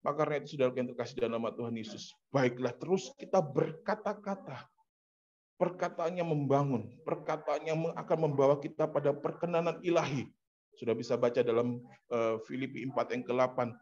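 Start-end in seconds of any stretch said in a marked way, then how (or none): 2.3-10.3 emphatic preaching. Real, not acted. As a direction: preach it out in measured, raised phrases. Preacher exhorts congregation. (khotbah bersemangat dengan nada tinggi)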